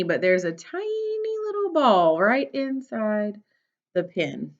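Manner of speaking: bright, lively storybook read; reading to children. Acted. Teacher reading a picture book to her class, bright and lively.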